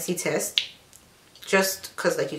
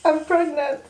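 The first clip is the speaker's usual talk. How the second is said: high-pitched, put-on anguished admission; distressed confession; 8 semitones higher than usual. Daughter faking pregnancy news to her mother, distressed.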